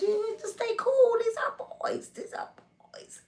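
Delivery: high, soft, breathy coaching; urgent whisper. Retold or imitated. A girl calming her nervous cousin before meeting the boys, whispering.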